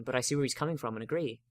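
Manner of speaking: plain reading voice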